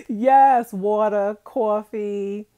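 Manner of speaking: loud, amused Brooklyn accent. Shown as a demonstration. Agreeing with a viewer about Brooklyn words, Brooklyn accent. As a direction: show off Brooklyn vowels on "water" and "coffee". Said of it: "especially when you say coffee".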